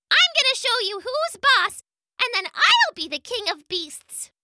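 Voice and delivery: very high, fast little bug voice; squeaky insect, fed up; tiny buzzing gnat voice. Acted. Gnat boasting.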